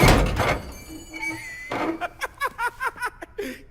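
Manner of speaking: high cackling laugh; loud teasing laughter; loud mocking laugh. Laughs at own taunt.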